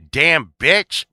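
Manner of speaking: high, loud punchy exclamation; mock outburst. Azura to Steve in retold show, mock outburst. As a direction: fling it out as a comic retort.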